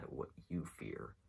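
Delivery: ordinary talk, nothing marked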